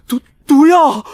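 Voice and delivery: high, stammered cry of alarm; frightened high yelp; panicked. Acted. Panics on discovering poison.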